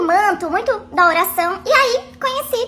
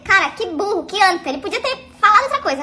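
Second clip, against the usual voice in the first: ordinary delivery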